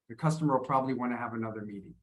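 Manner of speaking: "The customer will probably wanna have another meeting" fast, in one linked block; natural spoken reduction. Shown as a demonstration. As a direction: shrink "will" into "customer", slide through "wanna". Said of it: "That's my accent".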